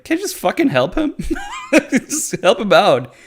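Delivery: hammed-up disbelief; exasperated plea. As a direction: beg them to just help, at wit's end. Exasperated plea at stupid villagers.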